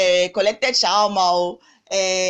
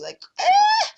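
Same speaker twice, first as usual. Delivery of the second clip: a sudden high yelp of pain mid-sentence; pained wince; 4 dB louder than usual. Real, not acted. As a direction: wince out loud as the wound stings. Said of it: "it's a little painful".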